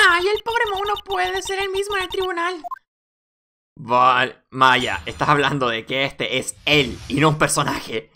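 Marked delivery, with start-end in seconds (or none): 0.1-2.6 put-on pitying girl character. Assistant pities the clown in court, high-pitched. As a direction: pity him in a high, even voice.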